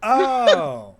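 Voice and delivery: sudden high exclamation; loud surprised oh. Acted. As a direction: burst out in surprise. NPC exclaims.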